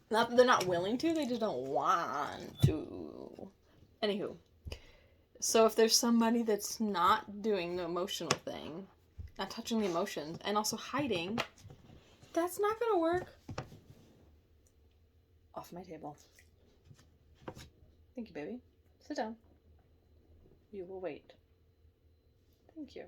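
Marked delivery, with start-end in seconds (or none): 1.1-3.5 slow, drawn-out emphasis. Real, not acted. 12.3-13.3 high emphatic no. Real, not acted. Emphatic rejection. 15.5-23.0 soft talk to the dog. Real, not acted. Talking to the dog.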